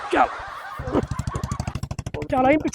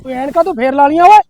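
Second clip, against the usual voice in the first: scolding shout; 9 dB louder than usual. Acted. Cop scolds rider about glasses.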